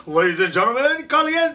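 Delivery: booming hype announcer call; fighting game announcer. Acted. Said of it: "like, announcer voice thing".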